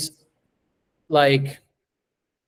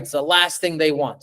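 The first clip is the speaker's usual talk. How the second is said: normal talking voice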